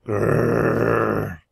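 long rolled growl; sustained grr of annoyance; grumbling growl. A narrator's reading. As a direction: growl in frustration, drawn out. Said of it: "I grumbled to myself".

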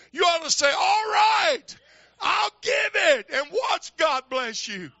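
loud, high, rising preacher's cry with slowed words; full-force preaching. Acted. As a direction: preach it out to the whole church.

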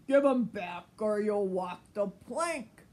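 loud pirate captain threat; loud angry pirate captain; captain's cry. Acted. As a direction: bellow it at the thieving crew. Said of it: "was the captain's cry".

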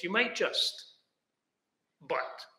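quiet, halting, a pause before "but". Retold or imitated. Preacher voicing God pausing mid-thought, halting. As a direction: break off after "just", then a soft "but". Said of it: "And then he pauses".

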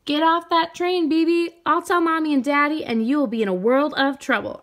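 loud, high warning shout; high loud big-sister yell at the boy. A narrator's reading. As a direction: yell after your little brother to get off. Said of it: "I yelled".

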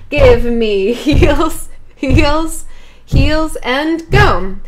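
drawn-out, rising count-in to the beat; teacher counting in, stretched out; slow count-in. Shown as a demonstration. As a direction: cue the class in slowly. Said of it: "counting in the beat".

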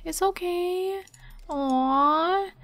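high, soft reassuring coo; cooing to pet. Real, not acted. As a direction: comfort the anxious pup softly. Cooing to the dog.

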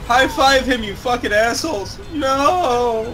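high-pitched gamer exasperation; exasperated calling out. Real, not acted. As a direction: yell at useless teammates, pitch way up. Calling out at teammates in game.

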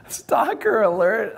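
very high, slow, drawn-out mock warning; high mock alarm. Musician replaying his hotel message to coach, high mock alarm. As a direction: stretch it out like a joke siren, high and loud. Mimics his own prank voicemail.

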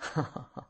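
a soft, acted villain's laugh; short laugh. A narrator's reading. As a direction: laugh quietly at the beaten man. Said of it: "The voice laughed".

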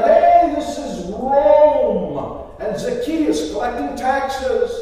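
loud, high call at a door; drawn-out tax collector's shout; yelling. Acted. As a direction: bellow it slowly like an official announcing himself. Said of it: "I knock on the door and I yell".

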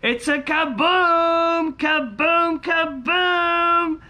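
high, stretched-out celebratory cries; excited whooping. Real, not acted. Scratcher announces the money bag to viewers, excited whooping. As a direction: whoop it up high, dragging the vowels. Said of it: "I get so excited".

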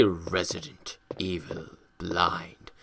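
hushed whisper. Acted. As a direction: whisper the title. Whispered channel intro.

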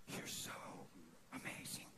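barely voiced whisper, broken by a pause; retold tearful awe. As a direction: whisper it in awe, as if remembering tears. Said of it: "I'm crying, going".

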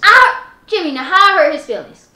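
put-on loud, indignant yelling at her boyfriend; angry shout. Sister yelling at Jimmy for defending the lying kid, loud and outraged. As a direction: yell it, outraged and accused.

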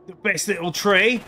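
attempted Australian that turns Cockney; lightly botched Australian accent sliding Cockney; slipping into Cockney. Streamer voicing a character admires the tree, accent slipping into Cockney. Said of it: "it just gets Cockney".

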